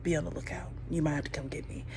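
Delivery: hushed, urgent phone whisper. Retold or imitated. Woman quietly asking her homegirl for help, whispered. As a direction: keep it secret, whisper it.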